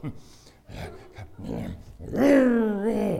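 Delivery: a villain's gloating laugh; the dentist mock-gloating over the drill, wicked laugh; evil laugh. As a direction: cackle like a mad dentist.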